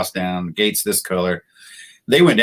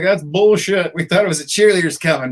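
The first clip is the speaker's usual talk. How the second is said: high-pitched retold complaint; mock protest; 8 semitones higher, 3 dB louder than usual. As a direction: imitate an indignant objection, pitched well up. Acting out protest at the news.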